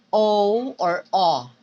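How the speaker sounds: o with rounded, protruded lips. Shown as a demonstration. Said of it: "you say oo".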